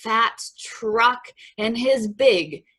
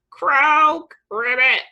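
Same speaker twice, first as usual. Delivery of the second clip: loud, raised croak and ribbit; playful frog noises; toad croak; 6 semitones higher, 6 dB louder than usual. A narrator's reading. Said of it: "Toad said".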